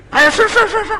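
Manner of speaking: eager yes-man. Retold or imitated. Restaurant boss happily agrees to the favour, high and fawning. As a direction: gush agreement like a pleased shopkeeper.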